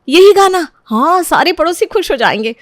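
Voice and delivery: high, loud fake enthusiasm; a sister-in-law overdoing her praise; gushing praise. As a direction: lay the flattery on thick.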